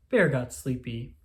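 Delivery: ordinary delivery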